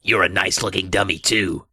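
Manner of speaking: raspy growl. A narrator's reading. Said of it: "in a harsh, raspy growl".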